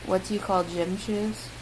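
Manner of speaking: normal talking voice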